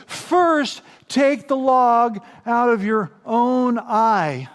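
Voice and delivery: First hit high, the rest slow; emphatic quote. Retold or imitated. Preacher quotes Jesus' command, First stressed. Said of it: "He says".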